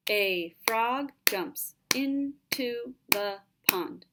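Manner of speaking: slow, each syllable spaced out; syllable counting. Shown as a demonstration. Teacher reads the line slowly so students count five syllables. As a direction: tap out every beat slowly. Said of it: "Let's look at the syllables".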